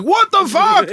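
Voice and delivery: high-pitched astonished outburst; baffled, high-flung demand for an explanation; incredulous question. Retold or imitated. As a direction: blurt it out, voice leaping way up. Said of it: "It was a question".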